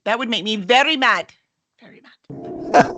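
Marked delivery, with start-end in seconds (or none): 1.8-2.9 slow, breathy mock threat; mock menace. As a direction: drag out Very mad as a playful warning. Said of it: "Don't wanna see me angry".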